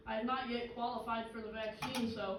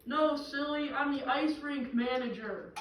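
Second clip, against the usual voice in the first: loud, higher proclamation from on high; voice from above; 4 semitones higher, 6 dB louder than usual. Acted. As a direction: call down a loud, exasperated correction. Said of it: "a voice from above says".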